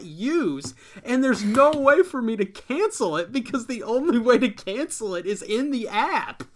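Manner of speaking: real exasperated rant, high and loud; really heated. Real, not acted. Subscriber unable to cancel a useless service, infuriated. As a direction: let it boil over, voice climbing loud and high. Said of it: "I'm getting really heated about this".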